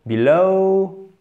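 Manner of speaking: final O gliding from E towards OU, "below"; diphtongue finale. Shown as a demonstration. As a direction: stretch the end of "below." into an E-to-OU glide. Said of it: "le E qui tend vers le OU". (diphtongue finale de « below. » glissant du E vers le OU)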